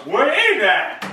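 high-pitched mock panic; alarmed retold question.

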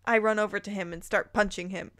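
ordinary delivery

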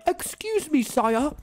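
high, drawn-out regal voice; slow, hammed-up royal politeness; mock queen. Friend showing how royals speak to a sire, high mock-queen voice. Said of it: "They speak like that, you know".

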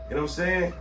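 usual speaking voice

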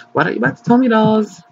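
mock husband voice. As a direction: play the clueless husband lightly. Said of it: "He's like".

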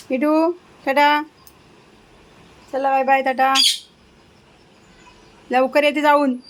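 loud, sing-song farewell to the parrot; loud goodbye to pets. Real, not acted. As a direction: call bye-bye across to the birds. Saying bye loudly to pets.

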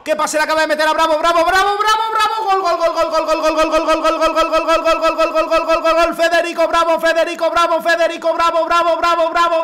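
high, loud, long goal cry; goal scream. Real, not acted. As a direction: scream gol over and over like a caster. Goal scored, commentator screams.

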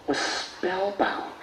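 ordinary talk, nothing marked

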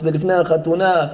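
usual speaking voice

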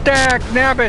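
high, frustrated mild curse; exasperated outburst. Real, not acted. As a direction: burst out over a failed attempt. Player failing at the game.

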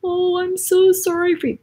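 high, drawn gushing pity; mock someone fawning with sympathy; over-sympathetic. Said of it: "not going to cater to, like".

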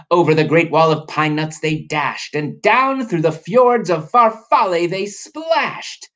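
sweeping rhymed verse, pitch climbing; story narration. A narrator's reading. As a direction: narrate the dash with rising, stretched drama.

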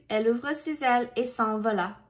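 plain reading voice

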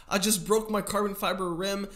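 mock chuckle running into the quote; chuckle, then say it lightly amused; imitated chuckle. YouTuber voices Robert chuckling over his broken rim, imitated chuckle. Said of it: "lets out this chuckle of a laugh".

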